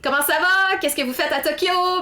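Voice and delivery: high, eager burst of French, quickening at the end; excited French greeting. Retold or imitated. Greeting French speakers in a Tokyo bar, high and excited. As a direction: greet the strangers like long-lost friends. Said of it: "and I'm like".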